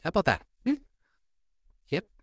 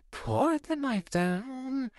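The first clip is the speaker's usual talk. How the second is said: scared plea; 12 semitones higher, much slower than usual. Acted. Kid holding a knife.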